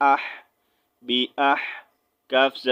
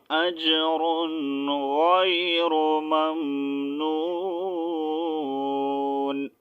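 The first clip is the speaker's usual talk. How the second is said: slow, melodic tajweed chant; Quran recitation; 2 semitones higher, much slower than usual. Shown as a demonstration. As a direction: chant the verse slowly and melodiously. Recites the finished verse.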